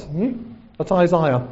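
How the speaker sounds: ordinary talk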